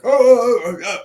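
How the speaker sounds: high, loud alarmed yelps; mock panic. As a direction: yelp like the ride just dropped. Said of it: "the guy up front's like".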